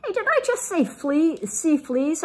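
a mother suddenly worried; Mama asking about fleas. A narrator's reading. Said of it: "asked Mama".

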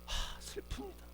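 soft, sighed lament; acted grief, breathed out quietly; sorrowful lament. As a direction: let a heavy sigh carry the words. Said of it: "기쁨으로 받아들이는 것이 아니라".

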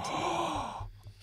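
sharp high gasp of fake outrage; mock offended gasp. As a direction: gasp as if wounded. Accused of being the mean one.

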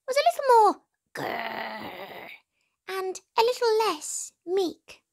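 high squeaky mouse thinking aloud; a small mouse hatching a plan; eager mouse voice. A narrator's reading.

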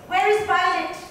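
loud, high angry call. Acted. Girl shouts for Violet, loud high call. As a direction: yell the name across the yard. Bully calling for her friend.

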